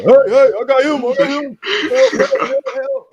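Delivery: loud high mock crowd shouting; kids yelling. Schoolkids in the crowd heckling, loud high yelling. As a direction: yell like excited school kids in the stands.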